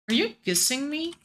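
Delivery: ordinary talk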